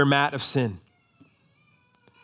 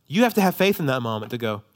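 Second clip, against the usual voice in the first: ordinary talk, nothing marked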